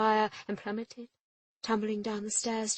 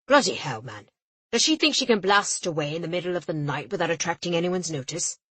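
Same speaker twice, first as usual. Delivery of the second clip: louder and indignant; an English official blustering in a rage; ranting official; 2 semitones lower, 10 dB louder than usual. A narrator's reading. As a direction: blast it out in exasperation.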